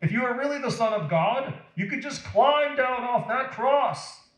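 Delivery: 높고 빠르게 도발하는 말투; 내려와 보라고 약 올리듯; taunting. Acted. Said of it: "they said".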